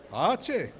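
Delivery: Brazilian gaúcho twang; gaúcho accent. Shown as a demonstration. Preacher showing the congregation how gauchos talk, gaúcho accent. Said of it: "como que o gaúcho fala".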